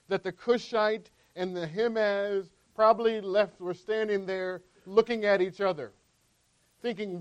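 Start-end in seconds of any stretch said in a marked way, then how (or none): none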